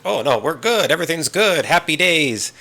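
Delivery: high, lightly mocked fake-happy voice; forced cheer. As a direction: paper over the weirdness with a high chirp. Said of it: "it's just like".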